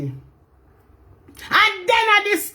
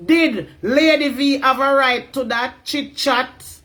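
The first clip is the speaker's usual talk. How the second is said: normal talking voice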